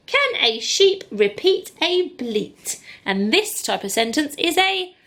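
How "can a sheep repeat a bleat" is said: slow, higher, word by word; slow reading. Shown as a demonstration. Teacher reading the question slowly to pupils. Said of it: "modelling slow reading".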